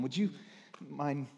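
usual speaking voice

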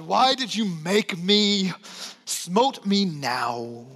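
mock whine, slow and drawn out; imitating discouraged people complaining to God; whiny complaint. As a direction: whine at God like a sulky child. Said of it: "we just whine".

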